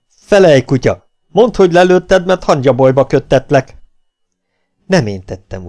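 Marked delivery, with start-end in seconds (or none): 0.1-3.7 loud, higher angry shout; furious captain. Acted. Captain roaring threats at the silent native, furious shout. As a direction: threaten him with fury.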